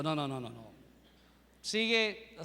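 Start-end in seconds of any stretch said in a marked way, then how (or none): 0.0-0.7 deep, mock-stern voice for the refusing pastor; low put-on refusal. Pastor turning the eager man away, low mock-stern voice. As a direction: drop the voice low to play the pastor saying no.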